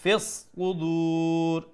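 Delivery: ফিস কুদুর with a long held u; slow chant stretching the দু of কুদুর; long madd at stop. Shown as a demonstration. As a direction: hold the last vowel on one note. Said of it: "যদি stop করে মধ্যে আরিজ".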